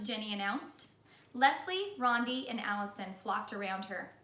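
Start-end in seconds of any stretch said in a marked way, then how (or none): none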